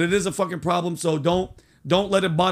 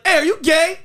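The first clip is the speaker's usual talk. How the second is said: blunt casual ask; 10 semitones higher, 6 dB louder than usual. Retold or imitated. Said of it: "people can't be like".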